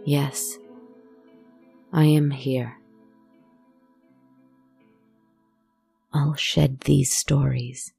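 haunting first-person storytelling; eerie narration. A narrator's reading. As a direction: tell it like a ghost story by the fire. First-person horror monologue over music.

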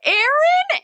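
very high squeal of a name; imitating delighted recognition. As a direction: mimic a thrilled mom squealing hello. Retells mom recognising her.

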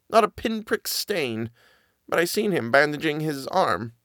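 high, halting servant voice, stumbling on the words; hesitant frightened nanny. Acted. Nanny stammers her story to Miss Genevieve, high and halting. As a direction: force out a secret she dreads telling.

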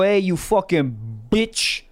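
slow, leaned-into mobster drawl; mobster accent. Retold or imitated. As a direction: lean into the tough-guy accent. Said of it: "when you do it in that accent".

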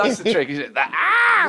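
put-on very high shouted old man; old Joe voice. Both argue as old Joe.

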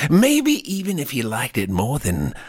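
normal talking voice

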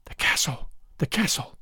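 breathless, hushed and urgent; panting whisper. A narrator's reading. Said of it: "said in a panting whisper".

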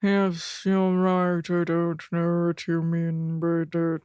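imitating Rob. Answering her co-host as Rob, low flat mumble. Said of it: "That's how he talks".

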